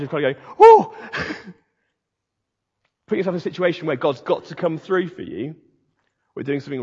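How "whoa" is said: loud, high yelp of alarm; mock-terrified whoa. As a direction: whoop as if teetering on the edge. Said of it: "terrified, like sort of edge".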